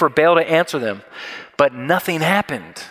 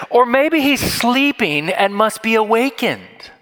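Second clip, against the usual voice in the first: slow, higher-pitched mock suggestion; taunting; 7 semitones higher, slower than usual. Elijah to the prophets of Baal, sassy taunting. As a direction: draw out the taunt, a little sassy. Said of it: "Elijah is taunting these prophets".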